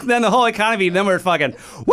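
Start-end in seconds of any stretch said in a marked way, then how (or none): 1.8-1.9 excited high whoop; roller coaster thrill. Pretend. Riding imaginary roller coaster.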